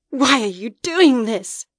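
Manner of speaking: an anguished challenge; demanding. A narrator's reading. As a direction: confront the shadow head-on. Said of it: "Mara demanded".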